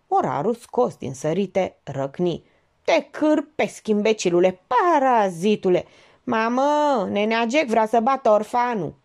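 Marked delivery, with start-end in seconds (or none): 2.8-8.9 high, loud mocking shout; mock yell. A narrator's reading. Penciu taunts Jack across the table, shouting. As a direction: yell like a cheeky orphan tattling. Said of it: "strigă Penciu".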